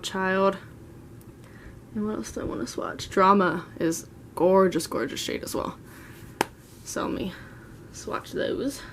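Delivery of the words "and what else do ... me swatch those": soft whisper to herself while swatching; hushed whispered aside while swatching. Real, not acted. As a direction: murmur it under your breath as you work.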